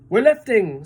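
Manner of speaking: loud sudden cry; shouted. A narrator's reading. One of the crew shouting that the peach lifts, loud. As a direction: announce the lift. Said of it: "somebody shouted".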